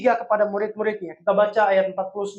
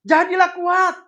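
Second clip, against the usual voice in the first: higher, louder, slow call to courage; rally them to be brave; rousing Jesus; 7 semitones higher, 4 dB louder, slower than usual. Acted. Jesus calling to terrified disciples, higher, louder and slow. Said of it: "Tuhan Yesus bilang begini".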